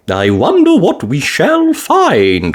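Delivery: slow, lightly voiced grandma; Granny Pig voice. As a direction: wonder aloud like a granny. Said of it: "said Granny Pig".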